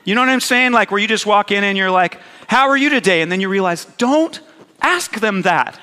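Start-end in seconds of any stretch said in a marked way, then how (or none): 2.5-3.2 chirpy, higher and louder hello; bright greeting. Retold or imitated. Greeting a bitter person too cheerfully. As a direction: sing it out like a polite stranger.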